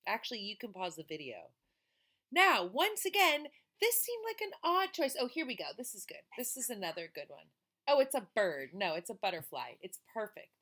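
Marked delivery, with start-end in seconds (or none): 2.3-5.2 bright, lively read-aloud voice; storybook reading. A narrator's reading. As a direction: read it to the children with energy.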